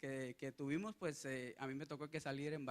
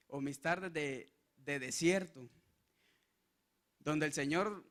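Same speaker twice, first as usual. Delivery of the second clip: ordinary delivery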